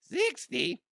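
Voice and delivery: squeaky, very high mock "sixty"; exaggerated voice crack. Shown as a demonstration. Podcaster mocking his own cracked voice, squeaked "sixty". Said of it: "My voice cracked".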